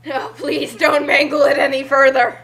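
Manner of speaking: ordinary talk, nothing marked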